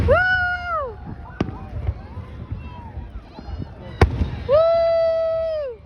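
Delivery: long, high whoop; cheering woo. Real, not acted. Cheering the Canada Day fireworks, high whoop. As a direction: give a high, drawn-out woo.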